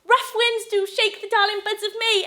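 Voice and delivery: high, loud, pushing on; distraught recitation. Acted. Wife wooing husband with sonnet, loud distraught recital. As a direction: force the verse out tearfully.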